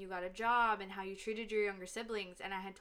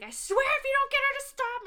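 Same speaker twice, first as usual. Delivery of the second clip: high, loud, rushed threat; exasperated mom; 14 semitones higher, 8 dB louder, faster than usual. Retold or imitated. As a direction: imitate a mom at the end of her rope, fast. Mom scolds child in retold story.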